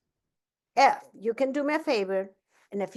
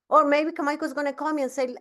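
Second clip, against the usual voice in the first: ordinary talk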